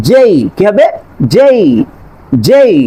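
জেই with a long gliding ei; correct J. Shown as a demonstration. Teacher drills জেই for students. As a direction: draw out জেই clearly. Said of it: "এটার প্রনাউন্সিয়েশনটা হবে".

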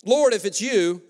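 loud, doubtful Peter; hammed-up doubting Peter; a loud, suspicious Lord if it's you; skeptical Peter. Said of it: "don't you love Peter?".

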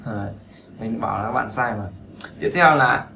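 ordinary talk

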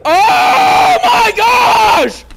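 drawn-out shocked cry; slow stretched panicked gasp. Real, not acted. Jumpscare panic.

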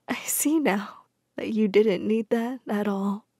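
soft, slow, frail whisper; weak whisper. A narrator's reading. Weak widow in hospital to Jane, whispering. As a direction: barely breathe the words. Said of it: "weak smile".

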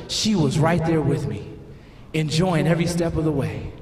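low, earnest closing words; solemn reflective message. Acted. Husband closing on love and God in your seat, solemn. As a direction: land the moral gently and sincerely.

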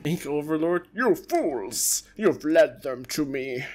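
loud, slow villain scolding; villain overlord. Acted. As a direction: berate your minions like a dark lord. Villain lord scolds minions.

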